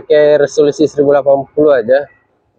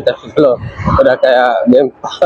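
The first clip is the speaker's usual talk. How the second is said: normal talking voice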